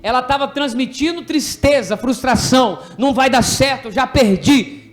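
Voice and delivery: loud, insistent preaching with punched words. Acted. Teacher drives home the lesson to children, preaching. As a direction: preach it to the class with force.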